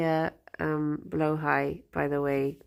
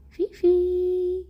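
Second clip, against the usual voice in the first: talking to a pet; 11 semitones higher, 4 dB louder than usual. Real, not acted. Calling pet in high voice.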